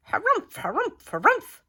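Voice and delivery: high, squawky huffing bird; indignant bird harumph. A narrator's reading. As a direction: huff like an offended bird. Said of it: "yelled a bonga bird".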